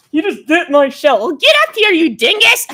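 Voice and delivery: high, loud exasperated yell; mock scolding. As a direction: shout it high in comic outrage. Mock scolding of biting puppet.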